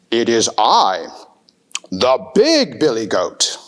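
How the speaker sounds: low, confident goat voice; big billy goat. Acted. Big goat answering the troll, low and confident.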